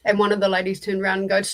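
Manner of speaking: usual speaking voice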